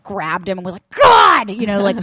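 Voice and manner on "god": high, loud exasperated cry; mad outburst. Retold or imitated. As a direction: blurt it out furious. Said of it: "so mad".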